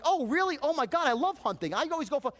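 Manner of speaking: over-eager rapport-faking voice; gushing enthusiasm. Retold or imitated. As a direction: gush like you've found a fellow fan. Mocking salesman's fake rapport enthusiasm.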